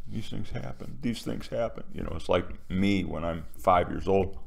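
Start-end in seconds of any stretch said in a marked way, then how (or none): 0.1-1.8 self-reassuring murmur. Retold or imitated. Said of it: "we the sheeple say, reassure ourselves".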